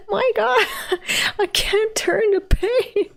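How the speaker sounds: high, pained wail breaking into laughter. Real, not acted. Reader too sore to turn the page, pained laughing wail. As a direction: wail and laugh through the ache. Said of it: "It hurts".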